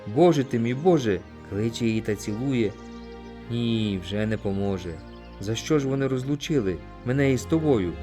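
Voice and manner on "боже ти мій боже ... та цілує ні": ordinary delivery, nothing marked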